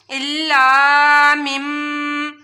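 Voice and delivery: الا مم with the مم hummed long through the nose; nasal ghunna. Shown as a demonstration. Teacher showing students the iqlab hum. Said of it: "نون کے ساتھ میم کو پڑھا جائے گا".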